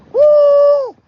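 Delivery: high, loud cheering whoop; a real high celebratory woo; excited whoop. Real, not acted. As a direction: whoop for the donor. Cheering a donor shout out.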